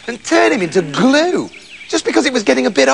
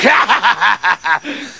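evil farmer's bursting cackle; wicked laugh. Acted. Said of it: "laughing wickedly".

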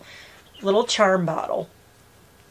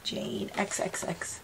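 barely voiced sign-off, read off the card; hushed reading of the card's closing; soft read-out; 13 dB quieter, slower than usual. Real, not acted. Reading the card's sign-off under her breath.

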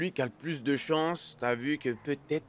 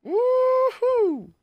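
excited shout; 13 dB louder than usual. Real, not acted. Sudden cheer.

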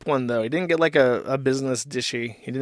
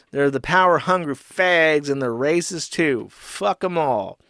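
Ethan Ralph; 3 semitones higher, 3 dB louder than usual. Acted. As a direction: play an aggrieved customer ranting. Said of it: "And he continues".

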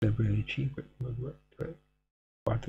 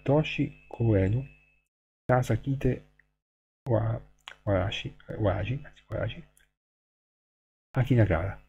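careful reading. Acted. Reading Japanese original aloud.

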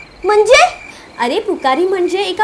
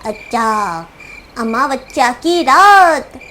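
slow spooked; 9 semitones lower, 3 dB quieter, much slower than usual. Acted. Child spooked by new moon.

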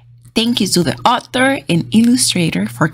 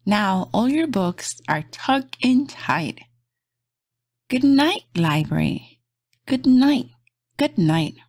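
gentle bedtime storybook read; reading to children; 4 dB quieter, slower than usual. Acted. Reading a bedtime picture book aloud to kids, soft and gentle. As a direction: lull little listeners toward sleep.

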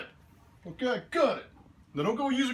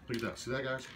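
ordinary talk

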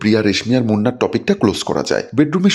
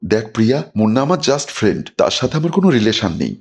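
plain reading voice

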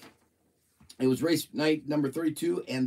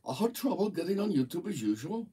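higher, badly faked Newfoundland accent; attempted Newfoundland accent; 6 semitones higher than usual. Host trying Robert's note in a Newfoundland accent. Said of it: "Newfoundland accent".

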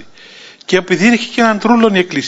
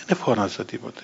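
low, very soft protest; quiet denial; 6 semitones lower, 11 dB quieter than usual. Retold or imitated. Priest answering an accusation of shouting, quietly. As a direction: deny it softly, dropping the voice.

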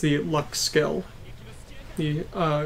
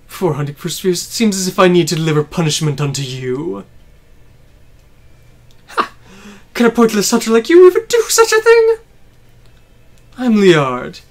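grandiose villain voice; 9 dB louder than usual. Acted. Voicing villain boss speech.

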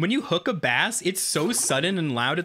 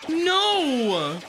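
frightened cry; 12 semitones higher than usual. Real, not acted. Bass attacks again.

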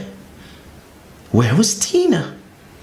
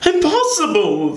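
startled disbelief; 11 semitones higher than usual. A narrator's reading. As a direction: blurt it out in shock. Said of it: "they said".

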